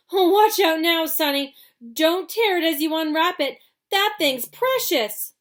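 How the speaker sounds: high, loud, frantic shouting; excited yelling. A narrator's reading. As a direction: holler it to the crowd. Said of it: "he yelled".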